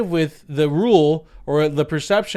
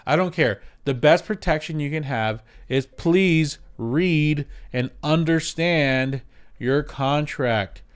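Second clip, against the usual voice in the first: ordinary talk, nothing marked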